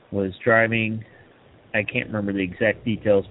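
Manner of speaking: ordinary delivery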